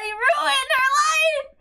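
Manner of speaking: high, slow, tearful cry; crying outburst. Real, not acted. Fan breaking down over Sayo's ruined life, sobbing shout.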